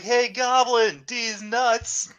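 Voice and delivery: high, slow sing-out to a pet; calling the cat. Shown as a demonstration. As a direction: address the cat with a drawn-out taunt. Demonstrates talking to his cat.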